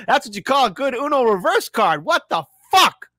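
rising disbelief, almost shouting; incredulous. Real, not acted. Streamer reacting in disbelief.

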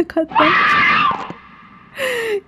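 sudden very high shriek breaking into a laugh; high scream of fright; startled shout. Real, not acted. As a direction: yelp it out at a jump scare. Jump scare in horror game.

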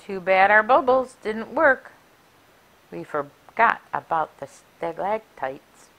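ordinary delivery, nothing marked

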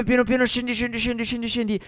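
high, soft, repetitive chant; small high eerie voice; acting out sinking. Acts out slowly sinking into quicksand.

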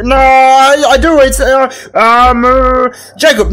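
high, drawn-out fibbing; hammed-up hesitation; stalling monster. Monster stalls, inventing a name.

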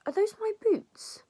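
high, loud outraged question; indignant surprise. Acted. Friend spots her boots on her pregnant friend, indignant. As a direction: burst out in shrill-pitched disbelief.

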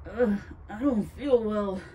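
slow, loud queasy groan; feeling sick. A narrator's reading. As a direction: groan it out with a stomach ache. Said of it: "Tom grabbed his stomach".

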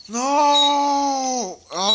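high, loud cry of no; anguished shout. Real, not acted. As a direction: scream it in dismay. Game loss outcry.

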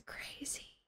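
hushed, stunned aside; amazed whisper. Real, not acted. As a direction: whisper it in disbelief. Freaking out over the tool.

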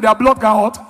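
ordinary talk

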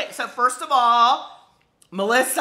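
ordinary talk, nothing marked

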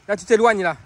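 ordinary talk

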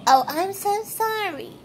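high, fake-sweet "sorry"; sarcastic, lightly voiced sorry; mock apology. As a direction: mimic an insincere apology. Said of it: "she sits up there and she goes".

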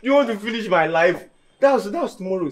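loud, high exclamation of dismay; dismayed outburst. Acted. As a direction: burst out in exaggerated alarm. Dismayed at having to wait.

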